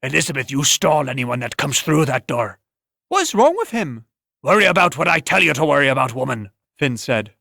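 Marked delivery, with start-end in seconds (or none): 0.0-2.6 gruff innkeeper. Acted. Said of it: "gruff voice". 3.1-4.0 a brassy woman demanding what's wrong with him; sharp tavern woman. Acted. Woman asks Finn. 4.4-6.5 gruff brusque order. Acted. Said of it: "gruff voice".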